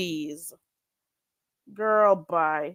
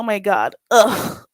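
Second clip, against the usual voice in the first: ordinary talk, nothing marked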